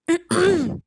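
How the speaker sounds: deliberate throat clear; acted little cough to get noticed; attention-seeking cough. A narrator's reading. Woman tries to rouse the resting man, pointed throat clearing. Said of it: "அப்படிங்கிற மாதிரி இருமுறா".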